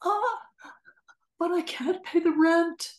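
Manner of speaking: high, stammering distressed widow; helpless victim voice. Shown as a demonstration. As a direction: falter and plead, voice up high. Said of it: "Back to the fan and Savannah".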